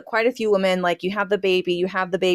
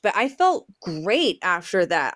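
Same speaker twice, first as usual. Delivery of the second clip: ordinary talk, nothing marked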